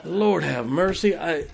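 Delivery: ordinary delivery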